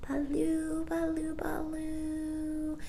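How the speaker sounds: high, soft murmured babble; baby murmur. A narrator's reading. Baby Barbara murmuring at the ceiling, soft babble. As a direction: murmur it sleepily. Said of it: "murmured Barbara".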